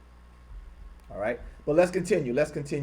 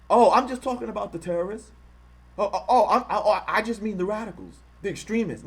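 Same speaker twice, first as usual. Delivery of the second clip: higher, flustered mock excuse with a laugh; mocking impression; 7 semitones higher, 4 dB louder than usual. Streamer mocking bigots caught out, flustered fake excuse. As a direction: stammer out a caught liar's excuse. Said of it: "when you call them out".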